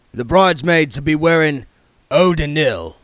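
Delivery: put-on the king sizing up the young man; Harry King voice. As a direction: voice the shrewd old yard boss sizing him up.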